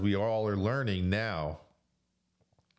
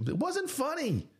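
much higher, pointed denial; high emphatic retort. Real, not acted. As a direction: snap it back high. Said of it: "repeated with emphasis".

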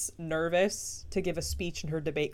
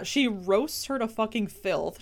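ordinary talk, nothing marked

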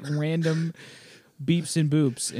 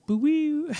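high squeaky Pokemon cry; cute creature noise. Retold or imitated. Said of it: "it's just like".

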